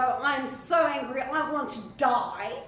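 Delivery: lightly voiced sulking prophet, slowing at the end; angry Jonah. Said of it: "you see Jonah go".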